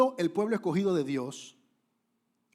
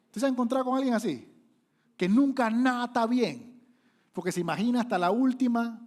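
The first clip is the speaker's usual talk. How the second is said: raised, higher preaching voice; loud preaching; 8 semitones higher, 7 dB louder than usual. Acted. Preacher calling out to the congregation, loud and high. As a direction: hammer the question home, loud and high.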